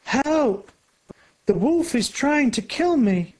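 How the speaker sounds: high frightened cry for help; a terrified little girl crying out; girl's scream. A narrator's reading. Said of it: "a girl screams".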